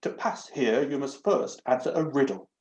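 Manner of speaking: a prim, fussy talking doorknob; lightly voiced haughty door knob.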